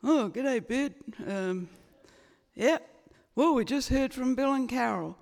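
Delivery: Australian parent voice sliding into American; slipping Australian accent. Retold or imitated. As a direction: attempt Australian and drift into American. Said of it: "I'm doing an American accent again".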